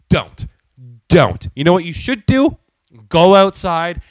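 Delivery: loud, punched-out comic threat; angry warning. Acted. Customer ranting at the cashier who judged his salad, angrily. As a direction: cut them off with loud, mock-furious orders.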